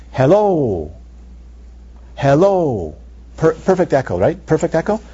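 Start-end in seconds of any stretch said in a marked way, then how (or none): 0.1-2.9 thespian voice. Shown as a demonstration. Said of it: "So watch".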